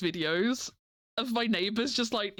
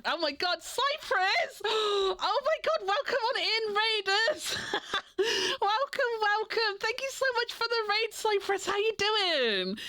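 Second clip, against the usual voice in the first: high, bright excited welcome; squealing with excitement at a raid; excited greeting; 10 semitones higher than usual. Real, not acted.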